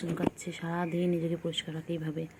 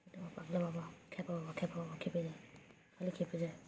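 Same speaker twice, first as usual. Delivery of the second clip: soft doting murmur; 10 dB quieter than usual. Real, not acted. Owner cooing over a crazy little pet bird, soft doting murmur.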